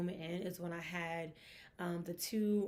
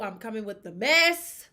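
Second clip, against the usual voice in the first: hyped; 2 semitones higher, 11 dB louder than usual. Real, not acted. Announcing gossip segment.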